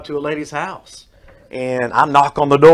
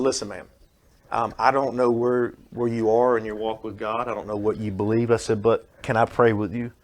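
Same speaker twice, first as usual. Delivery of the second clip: softer, lower, tender asking; gentle offer to pray; 4 semitones lower, 6 dB quieter than usual. Retold or imitated. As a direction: quietly offer comfort to her. Offering prayer to grieving lady.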